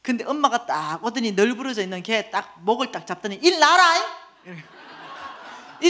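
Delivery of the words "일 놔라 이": high, loud mock scolding; hammed-up rough command; bossy mother's bark. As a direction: order it like a fierce mother. Mother grabs man by neck, orders.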